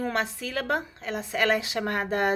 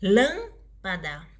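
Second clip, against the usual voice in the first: slow "Lâmpada", long "lâm" then quick "pada"; stress on LÂM; 2 semitones lower, much slower than usual. Shown as a demonstration. Teacher giving a proparoxytone example, stress on LÂM. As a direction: punch and stretch the "lâm" in "Lâmpada". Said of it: "antepenúltima sílaba mais forte".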